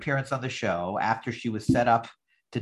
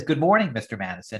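ordinary delivery

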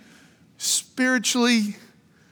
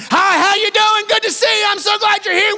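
hearty shouting greeting; 10 semitones higher, 4 dB louder than usual. Retold or imitated. Peter grabbing a sleepy believer by the shoulder, shouting. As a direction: shake them awake with a big shouted greeting. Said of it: "he gets him by the shoulder".

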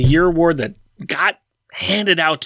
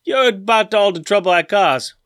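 bad Cajun accent; 2 semitones higher than usual. Podcaster reading a thief's comic-book confession, bad Cajun accent. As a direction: attempt a Cajun accent, badly. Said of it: "That is my worst Cajun accent ever".

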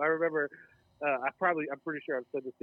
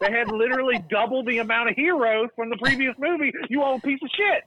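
much louder and higher, exasperated; furious fan yelling about hypocritical reviewers; indignant rant; 11 semitones higher, 10 dB louder than usual. Real, not acted. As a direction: blast the critics with disbelief. Ranting at movie critics.

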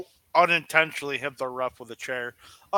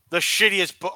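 ordinary talk

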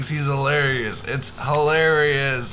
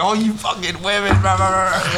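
mock emotional rant; 4 semitones higher than usual. Comedian teasing co-host about his feelings, mock angry rant. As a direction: parody his bitter outburst, hammed up. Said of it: "having this flood of emotions back".

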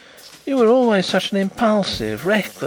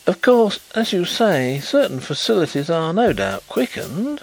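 considerate concession at raised pitch; placating, higher-voiced agreement; considerate haste; 8 semitones higher than usual. A narrator's reading. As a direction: hasten to reassure him considerately. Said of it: "hastened to add considerately".

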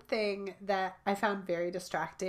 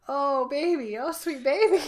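higher, louder laughing coo; cooing at child; 6 semitones higher, 7 dB louder than usual. Retold or imitated. Mother retelling cooing to her son, higher and louder with a laugh. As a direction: comfort him with a laughing "oh baby".

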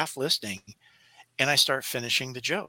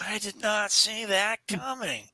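slow, higher, nasal drawl; Owen Wilson; 8 semitones higher than usual. Shown as a demonstration. Guest demonstrating an Owen Wilson impression. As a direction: stretch it out, laid-back and amazed. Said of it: "Owen Wilson impersonation".

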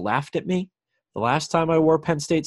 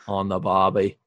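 Australian accent; 6 semitones lower than usual. Shown as a demonstration. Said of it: "those weirdos down under".

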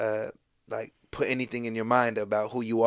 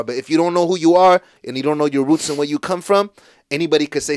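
emphatic; 5 semitones higher, 9 dB louder than usual. Real, not acted. Passionate point about roots.

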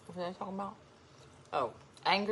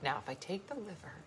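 very soft, near-whispered musing; hushed imagined voice; 15 dB quieter than usual. Acted. Woman voicing what the watcher is thinking, hushed musing. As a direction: murmur it like private scheming, slowing into pauses. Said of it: "She's like".

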